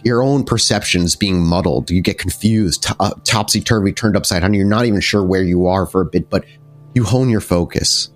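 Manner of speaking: usual speaking voice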